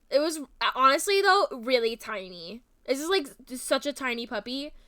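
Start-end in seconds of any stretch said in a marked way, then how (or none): none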